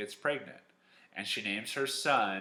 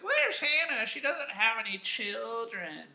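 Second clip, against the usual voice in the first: very high mocking singsong; taunting townspeople; 14 semitones higher, 4 dB louder than usual. Townspeople pointing fingers at Hannah, mocking high-pitched taunt. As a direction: tease in a squeaky, exaggerated voice. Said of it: "everyone in the town would, like, point fingers".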